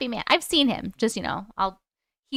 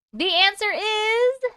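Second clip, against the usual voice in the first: suspense build-up; 9 semitones higher, much slower than usual. Real, not acted. Announcing the answer.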